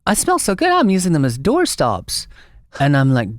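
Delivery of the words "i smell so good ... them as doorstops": high-pitched comic Texan woman; Texas accent. Retold or imitated. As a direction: put on a funny, much higher Texas accent. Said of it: "in her funny Texas accent".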